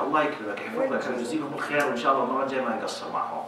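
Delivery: a hint of a gentle husband thanking his wife; soft gratitude. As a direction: quietly reassure her, no blame. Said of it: "بالعكس قال لها".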